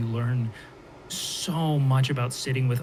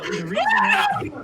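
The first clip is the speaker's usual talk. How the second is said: laughing shout; 6 dB louder, slower than usual. Real, not acted. Bursts out laughing mid-explanation.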